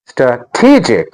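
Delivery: "strategic" slowed, with a long TEE; stress on second syllable. Shown as a demonstration. Teacher modelling the next word, "strategic" slowly stressed stra-TEE-jic. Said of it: "Listen again".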